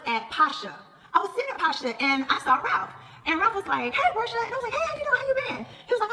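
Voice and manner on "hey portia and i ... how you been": high-pitched imitated greeting; retold greeting. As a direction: act out a hello, pitched well up. Acting out a friendly greeting exchange.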